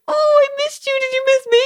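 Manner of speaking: very high, gushing pet voice; squeaky-high ironic cooing; baby talk. Retold or imitated. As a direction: coo over the cat in mock adoration. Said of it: "baby talk to their dog".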